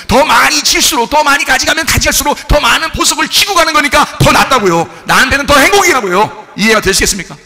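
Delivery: loud, high impassioned preaching, slowing on key words; full-force preaching. Acted. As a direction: preach it like the climax of the sermon. Sermon climax at full force.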